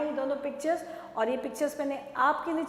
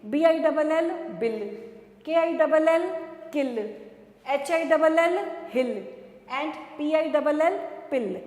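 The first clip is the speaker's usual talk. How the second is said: louder, higher, punching each बिल, किल, हिल, पिल ending; louder, higher sound drill; emphatic spelling out; 3 semitones higher, 4 dB louder than usual. Shown as a demonstration. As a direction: spell it loud so kids hear the इल ending. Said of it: "आपने सुना मैंने कैसे बोला?".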